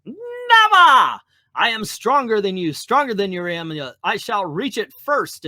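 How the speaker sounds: big high shout on Never, then grand boasting; defiant villain cry. Acted. Baron Mordo defying Strange in the power race, loud villainous cry. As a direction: roar the refusal like a comic villain.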